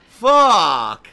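sudden, very loud curse; startled yell. Real, not acted. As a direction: blurt it out in real fright. Scared by game monster.

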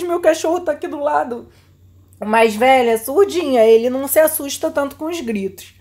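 ordinary talk, nothing marked

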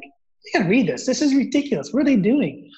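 frustrated. Retold or imitated. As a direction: vent to your wife, pitch climbing high. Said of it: "I was so frustrated".

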